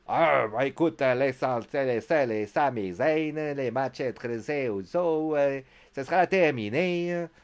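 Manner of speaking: slow, drawn-out bad Québécois accent. Recapping a wrestler's threat to end the matches, in a bad Québécois accent. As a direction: stretch the vowels in a mock Québécois accent. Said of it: "mon accent est québécois est pourri".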